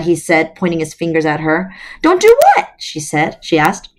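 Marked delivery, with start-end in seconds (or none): 2.0-2.7 much higher and louder, defensive; indignant question. Acted. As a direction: fire it back in surprise. Alex protesting, pitched up.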